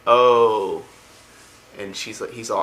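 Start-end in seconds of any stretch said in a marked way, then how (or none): 0.1-0.8 loud, drawn-out oh of understanding; acted sudden realization; dawning realization. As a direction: let the penny drop, loudly. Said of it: "finally gets it".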